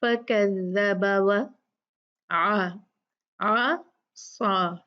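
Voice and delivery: slow, all-light letters; slow light recitation; beautiful recitation. Shown as a demonstration. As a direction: recite lovingly and slowly. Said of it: "इतना प्यारा लगता है".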